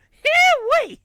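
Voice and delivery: very high, loud silly whoop; goofy whoop. Acted. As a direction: let out a hillbilly hoot. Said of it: "the most Huck thing of me ever".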